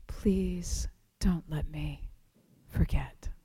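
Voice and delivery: fading whispered plea; whispered prayer. Retold or imitated. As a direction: let the plea fade to almost nothing.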